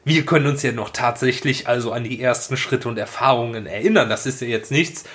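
ordinary talk, nothing marked

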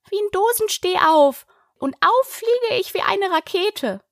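small, very high squeak; weak squeaky voice. A narrator's reading. Dazed Wabbel tells the others what happened, weak and squeaky. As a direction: squeak it feebly like a tiny creature. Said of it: "eine kleine, schwache, quiekende Stimme".

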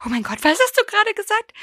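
high mock-shocked gasp of a mother. Mother catching her kid swearing, high mock shock. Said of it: "sie so".

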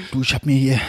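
low dad impression; imitated Hessian father. Father telling his son what he eats, imitated low Hessian. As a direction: start explaining in Dad's voice. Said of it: "andere Personen auf Hessisch nachmachst".

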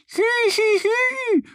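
tiny high duck voice calling out; a little duck calling to the foals from far off. A narrator's reading. Annie calling the foals across the field, high duck call. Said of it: "ruft Annie den Fohlen von weitem zu".

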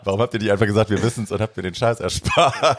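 retold protest building toward a laugh; mock indignation. Friend's mother asking why nobody told her, mock indignant complaint.

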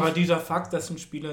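talking with mouth full. Real, not acted. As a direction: speak through a mouthful of food. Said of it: "dass ich mit vollem Mund spreche".

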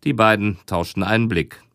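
usual speaking voice

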